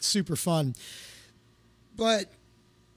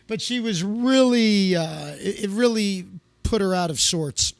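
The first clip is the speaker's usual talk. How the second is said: normal talking voice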